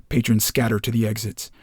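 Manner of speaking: plain reading voice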